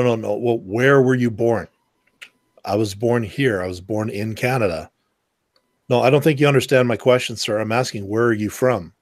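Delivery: usual speaking voice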